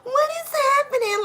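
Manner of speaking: high, rising panicked voice; mock panic. Imitating a woman panicking at her reflection. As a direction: act out panicking in the mirror. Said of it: "you was like".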